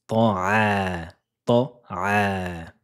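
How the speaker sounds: slow drawn طعا with the throaty ain sound; slow pronunciation drill of طعا; Arabic letter ain. Shown as a demonstration. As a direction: stretch out طعا to show the ain. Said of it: "c'est la lettre Ain".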